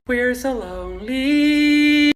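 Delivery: slow, drawn-out mock sadness; mock lonely whine. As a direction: stretch it out like a sad puppy. Mock sad aside.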